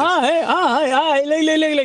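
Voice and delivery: jumping in loudly to claim his turn; loud interruption. Real, not acted. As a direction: barge in and grab the floor. Cutting in to answer the pick.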